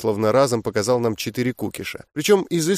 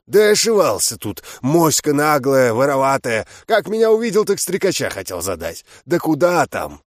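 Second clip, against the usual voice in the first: orc growl. A narrator's reading. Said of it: "рыкнул".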